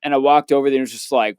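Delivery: usual speaking voice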